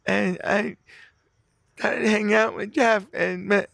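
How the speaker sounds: slow, high weepy whine; mock sobbing voice; imitated tearful confession. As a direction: drag it out high like someone crying. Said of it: "just starts crying".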